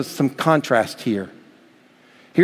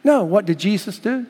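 usual speaking voice

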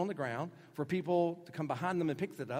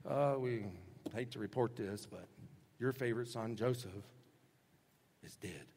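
quieter, lower and sinking to a hush; somber bad news; 5 semitones lower, 5 dB quieter than usual. A touch of grim sorrow. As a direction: break the news gently and gravely. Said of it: "took it back to their dad and said".

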